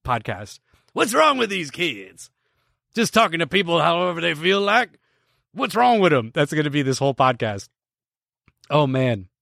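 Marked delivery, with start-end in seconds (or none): 0.9-6.3 put-on high-pitched grumpy old man complaint; playing the cranky old man yelling about kids; hammed-up old man gripe, pitched higher; mock old man rant. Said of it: "an old man screaming podcast".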